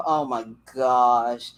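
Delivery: ordinary delivery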